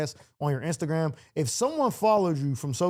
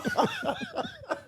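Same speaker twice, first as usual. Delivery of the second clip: high, helpless laughter; real laughing fit; 6 dB quieter than usual. Real, not acted. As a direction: crack up at the innuendo. Cracking up at accidental innuendo.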